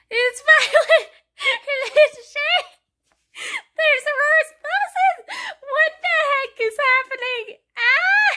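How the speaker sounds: high, loud, slow teary squeals ending in a shout; overwhelmed fangirl squealing; excited shriek. Real, not acted. Reader squealing over a romantic reveal, high and loud.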